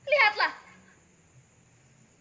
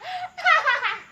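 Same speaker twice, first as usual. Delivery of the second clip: gloating laugh; 9 semitones higher than usual. Acted. Woman dubbed a killer, laughing in triumph.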